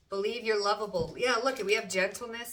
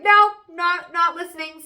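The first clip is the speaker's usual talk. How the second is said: high, loud playful nope; mock refusal; 11 semitones higher, 14 dB louder than usual. Reader voicing the querent ignoring temptations, loud mock refusal. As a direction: shut it down loudly. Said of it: "You're like".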